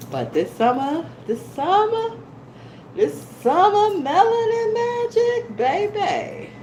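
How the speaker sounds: high, slow gushing delight; excited. Real, not acted. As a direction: sing out your summer joy. Gushing over summer tan.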